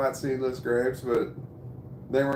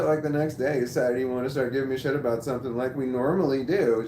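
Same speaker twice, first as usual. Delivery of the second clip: ordinary talk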